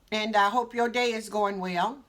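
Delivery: ordinary talk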